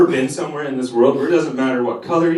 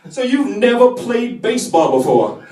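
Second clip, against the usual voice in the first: Foghorn voice; 8 semitones higher than usual. Retold or imitated.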